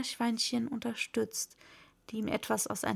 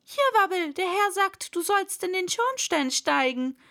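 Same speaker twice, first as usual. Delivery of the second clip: higher, raised calling voice; calling out an order; 7 semitones higher, 5 dB louder than usual. Acted. One animal passing the master's order to Wabbel, calling out. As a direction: call over to someone out of sight.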